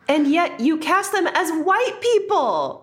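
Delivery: high-pitched, incredulous protest; indignant outburst. Real, not acted. Co-host objecting to the casting contradiction, indignant high-pitched outburst. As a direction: call out the hypocrisy in a high voice.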